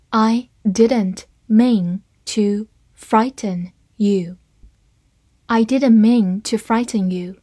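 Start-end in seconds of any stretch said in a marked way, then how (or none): 0.1-4.4 slow, careful model reading. Shown as a demonstration. Teacher doing one slow read of the sentence, slow and clear. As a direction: say it slowly, syllable by syllable. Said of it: "好，慢读一遍".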